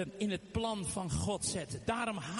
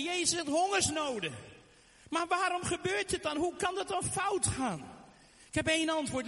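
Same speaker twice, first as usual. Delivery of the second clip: fiery preaching; 6 semitones higher than usual. Acted. Preacher rousing the congregation about hunger, fiery preaching. Opens sermon at full force.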